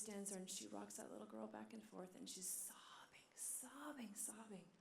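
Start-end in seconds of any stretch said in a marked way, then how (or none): 2.7-4.7 soft, breath-filled whispery repetition; hushed, tender. A narrator's reading. Mother rocking her crying little girl, told in a hushed voice. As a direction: let the word fade into a hush. Tender retelling of child sobbing.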